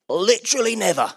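loud, high preacher's exclamation; emphatic denial. Real, not acted. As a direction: throw it out forcefully to the whole room. Preacher answering own rhetorical question.

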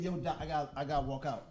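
soft low retold aside to the doctor; retold quiet aside. In the orthodontist's chair, a softly spoken quote to the doctor. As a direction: mutter it to the doc. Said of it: "I said".

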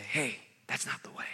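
hushed, breathy whisper; whispered correction. Acted. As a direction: whisper a gentle word of correction. Voicing a gentle correction.

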